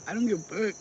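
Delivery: ordinary talk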